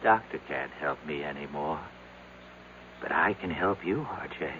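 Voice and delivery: a dying plea to R J; fading whisper. Acted. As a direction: drift off with the last of your strength. Weakened man collapsing, last warning.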